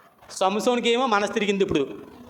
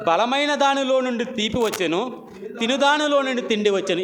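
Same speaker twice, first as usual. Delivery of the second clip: solemn riddle recitation; 7 semitones higher than usual. Acted. Reciting Samson's riddle to congregation.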